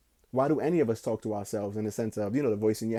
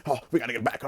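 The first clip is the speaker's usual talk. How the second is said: frantic movie line; faster than usual. Retold or imitated. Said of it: "He was wilding, like".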